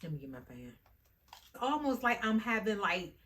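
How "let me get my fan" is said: much softer, lower; soft, lower, worn-out aside; drained mumble. Real, not acted. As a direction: mumble it tiredly to yourself. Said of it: "hot flash fatigue".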